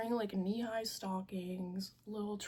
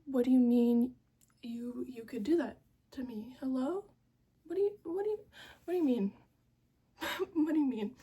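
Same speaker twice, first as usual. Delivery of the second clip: shocked outburst; 2 semitones higher, 7 dB louder, faster than usual. Retold or imitated. Shocked reply to creepy threat.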